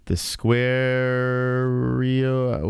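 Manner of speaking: very slow, stretched-out name; mock ring-announcer stretch; drawn-out announcing. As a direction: hold the middle of it in one long note. Drawn-out reading of on-screen name.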